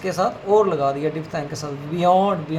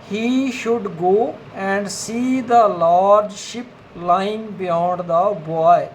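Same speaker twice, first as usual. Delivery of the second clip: each word spaced out, very slow, for students writing along; slow dictation; much slower than usual. Shown as a demonstration. Teacher dictates a sentence to shorthand students, very slowly. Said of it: "अगला sentence जो है fourth".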